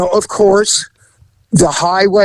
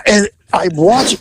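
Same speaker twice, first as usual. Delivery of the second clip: ordinary talk, nothing marked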